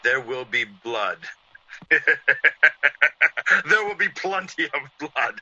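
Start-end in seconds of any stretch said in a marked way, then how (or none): 1.5-3.6 loud gloating villain's cackle; evil laugh. Acted. Killer cackling over the blood to come. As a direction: relish the coming murder.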